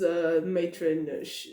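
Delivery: usual speaking voice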